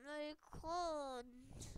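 ordinary talk, nothing marked

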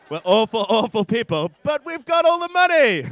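mock lament rising to a loud high yell; building to a shout. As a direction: build up, then crow the boast. Mock self-accusation building to a boast.

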